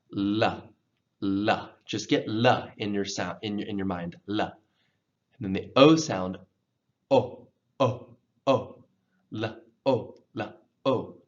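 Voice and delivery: la and o, clear and separate; sound drill. Shown as a demonstration. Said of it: "let's practice".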